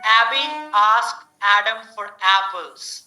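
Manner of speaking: slow, open æ on each A; slow drill reading. Shown as a demonstration. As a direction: read slowly, stressing the æ. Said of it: "Sentence, a sound".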